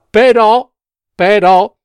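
interrupting. A narrator's reading. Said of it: "interruppe la consigliera Topina".

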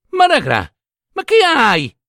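calling out in fright to her sister; alarmed shout. A narrator's reading. Sister to pale Poponè arriving home, alarmed shout. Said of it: "le gridò".

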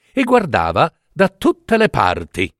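plain reading voice

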